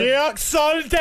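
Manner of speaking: put-on Jamaican accent. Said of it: "let's do an act out".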